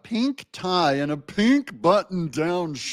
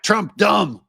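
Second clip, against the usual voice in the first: higher, louder two-word mock slogan; mock crowd taunt; 6 semitones higher, 5 dB louder than usual. Mocking Democrats who just sit there, simplistic slogan. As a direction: toss it out as an easy cheer. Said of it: "they could just sit there and go like".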